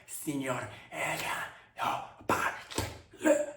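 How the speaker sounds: put-on slow Spanish-accented correction, Barcelona lisped. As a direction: play a Spaniard slowly fixing the word, in a Spanish accent. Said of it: "they're like".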